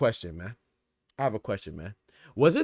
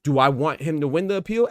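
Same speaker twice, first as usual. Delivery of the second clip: ordinary delivery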